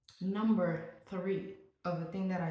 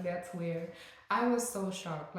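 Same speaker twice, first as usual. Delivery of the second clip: usual speaking voice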